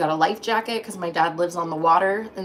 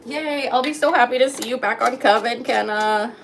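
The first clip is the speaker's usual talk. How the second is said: higher, gushing welcome back; excited welcome; 5 semitones higher, 4 dB louder than usual. Real, not acted. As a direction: beam at a returning friend.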